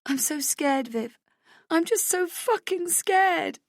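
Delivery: high, slow, tearful sob through the words; sobbing. Acted. Narrator sobbing to her friend in the toilets, high and slow. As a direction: choke it out, terrified and crying. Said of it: "I sob".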